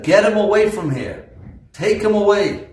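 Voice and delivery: high, loud order to remove him; commanding yell. Retold or imitated. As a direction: shout him out of the room. Chafetz Chaim orders him removed.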